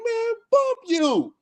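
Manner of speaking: high, slow, whiny retort; retelling a reckless comeback; mock defiant retort. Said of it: "I'm like".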